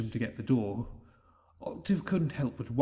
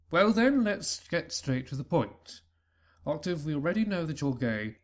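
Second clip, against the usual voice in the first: father announcing it plainly to son; hearty announcement; 5 semitones higher than usual. Acted. Father drops big news.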